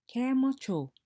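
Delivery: high, loud call, "Ke mo ga"; lively teacher calling to students; call-and-response teacher call. Shown as a demonstration. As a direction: call out the prompt to the class. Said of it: "you say, I say".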